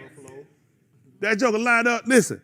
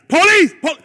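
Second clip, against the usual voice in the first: loud, higher call for the police; mock shout for the cops; 6 semitones higher, 10 dB louder than usual. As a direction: shout it across the room. Calling out for police.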